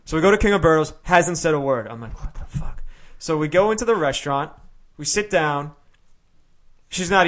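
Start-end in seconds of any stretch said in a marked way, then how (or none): 2.2-2.8 breathy unvoiced aside; whispered what the fuck. To himself about his silent date, whispered. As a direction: whisper it under your breath.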